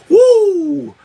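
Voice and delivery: breathless laugh into a high loud "woo"; helpless laugh, then a piercing whoop; involuntary whoop. Real, not acted. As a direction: whoop as the shock hits. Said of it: "I'm involuntarily shaking".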